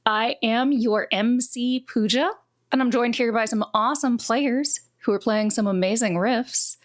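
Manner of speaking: normal talking voice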